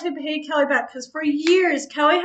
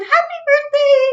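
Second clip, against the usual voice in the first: much higher, sing-song happy birthday; cheery birthday call; 12 semitones higher, 5 dB louder than usual. Retold or imitated. Reading the birthday balloon aloud to a friend, cheery. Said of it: "It said".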